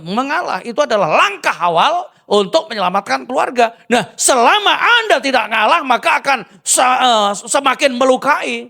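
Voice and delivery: forceful preacher exhortation; preaching at full force. Acted. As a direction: press the lesson on the listeners. Preaching the main point loudly.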